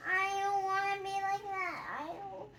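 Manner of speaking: pleading in a squeaky little pig voice; voicing Piggy. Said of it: "She's just like".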